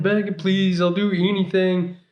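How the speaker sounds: high, imploring mock plea; retold begging. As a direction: plead as if bargaining with God. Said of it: "just the begging".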